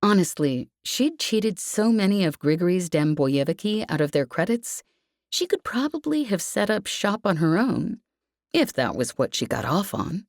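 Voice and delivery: ordinary delivery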